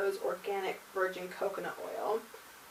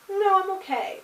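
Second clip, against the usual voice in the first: polite refusal; 12 semitones higher, 9 dB louder than usual. Retold or imitated. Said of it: "I was like".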